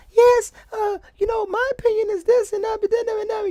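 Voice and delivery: very high, tiny, mocking woman's voice; little bitty voice. Mocking a woman on the show, in a tiny, very high voice. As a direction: squeak it out like a dainty lady mocking. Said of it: "that little bitty voice".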